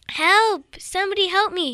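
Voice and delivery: high, loud call for help; crying for help. Acted. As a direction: shout for rescue from a floating tomato. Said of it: "he started crying".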